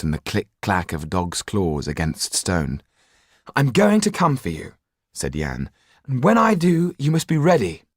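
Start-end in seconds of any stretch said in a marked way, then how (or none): 3.5-7.8 raised, higher, pressing warning; desperate plea to act now; urgent young Jan. Acted. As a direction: urge him, the danger is close. Jan promises rescue.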